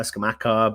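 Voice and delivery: "Scamacca" with a hard "k", ska-MAK-a; variant pronunciation. Shown as a demonstration. Host running through name variants, "Scamacca" said with a hard "k". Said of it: "however you want to pronounce his name".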